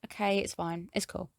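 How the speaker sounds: soft, casual mock shrug; shrugging it off. Heroine answers Kiro's apology text, shrugging it off. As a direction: toss it away quietly, imitating indifference. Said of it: "she shrugs it off".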